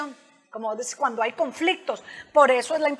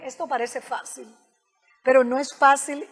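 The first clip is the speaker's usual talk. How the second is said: ordinary talk, nothing marked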